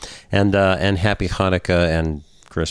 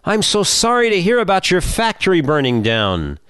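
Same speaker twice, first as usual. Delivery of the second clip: yelling; 10 semitones higher than usual. A narrator's reading. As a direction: yell it, hand on heart. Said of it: "puts his hand to his heart and yells".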